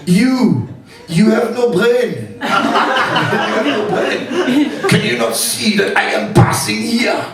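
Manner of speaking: put-on indignant high-pitched French accent. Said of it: "with the French accent".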